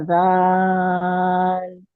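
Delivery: जाल with the long vowel held out very slowly; six-count elongation. Shown as a demonstration. Teacher demonstrating a stretched madd on जाल. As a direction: draw out जाल in recitation. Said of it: "six counts खींचेंगे".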